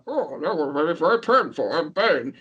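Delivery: lightly done, muffled-style villain impression; a hint of the masked villain's voice; Bane voice. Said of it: "you know Bane's voice in Batman".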